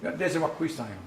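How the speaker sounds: "Yatewakwistayo" with an added "za" after "Yate"; extra syllable inserted. Shown as a demonstration. Uncle giving the correct form to a learner, "Yatewakwistayo" with "za" in the middle. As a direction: slip a short "za" into the middle of "Yatewakwistayo". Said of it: "I heard a little something in the middle".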